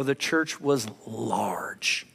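hushed, breathy aside; awed whispered aside. Real, not acted. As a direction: whisper it like a secret marvel. Awed aside after numbers.

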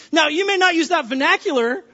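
ordinary delivery

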